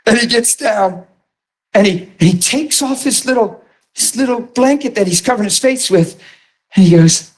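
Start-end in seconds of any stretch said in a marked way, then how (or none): none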